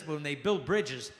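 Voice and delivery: ordinary talk, nothing marked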